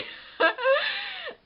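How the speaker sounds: high shrieking laugh, close to crying; burst of laughter. Real, not acted. Laughing at the guy she is telling about, a squealing laugh. Laughing at her own story.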